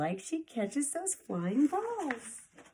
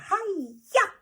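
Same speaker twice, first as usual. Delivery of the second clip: high, loud "Hi-ya!" strike cry; karate yell; 12 semitones higher, 8 dB louder than usual. Acted. Sister slaps the dragon's chin, a high loud karate yell. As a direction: shout it like a karate chop.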